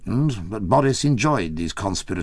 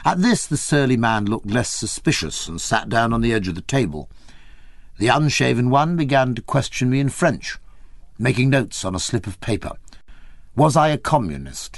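plain reading voice

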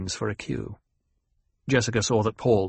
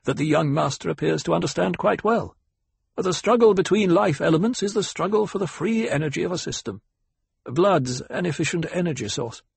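pitched-up, lively expert voice; ecologist explaining; 7 semitones higher than usual. Acted. Ecologist backs the young master before the table, expository. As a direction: expound the principle with assurance.

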